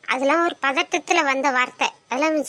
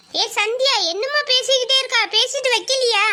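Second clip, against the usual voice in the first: higher mother calling out, a bit impatient; 7 semitones higher than usual. Acted. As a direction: call to the daughter as the mother, pitched up. Mother calls from outside.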